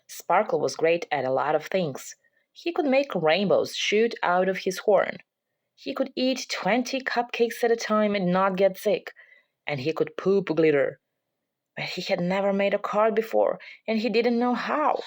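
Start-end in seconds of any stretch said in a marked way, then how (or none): none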